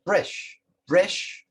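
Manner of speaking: Bresh said with an e, not u, rising; dialect word bresh. Shown as a demonstration. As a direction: bresh with lifted end for brush. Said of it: "for a brush".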